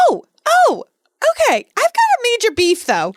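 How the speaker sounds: high, excited burst; excited outburst. Real, not acted. To her co-host, excitedly airing a major beef. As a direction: launch into the complaint. Building up to a rant.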